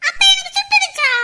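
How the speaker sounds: put-on high, loud little kid's shout; child's shout. Little child shouting worried question at mother, high and loud. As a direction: shout it like an alarmed small child.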